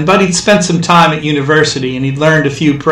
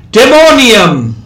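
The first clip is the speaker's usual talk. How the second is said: distant crowd cry; 7 semitones higher, 5 dB louder, slower than usual. A narrator's reading. Word floating from crowd.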